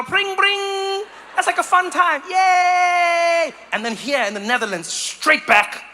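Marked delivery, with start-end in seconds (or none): 0.0-1.0 high, cheerful pring pring of a bike bell; bell imitation. Comic imitating a happy bicycle bell. 2.3-3.5 put-on high, loud childlike yay; mock cheer. Comic playing a joyful cyclist, hammed-up cheer.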